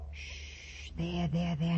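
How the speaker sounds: soft, low comforting coo; soothing hush. Acted. Polly soothes the crying baby, soft and low.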